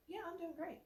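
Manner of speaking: high but soft, hollow "Yeah, I'm doing great"; fake-fine and indifferent. Shown as a demonstration. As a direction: brush it off with a thin high "great". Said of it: "indifferent to life right there".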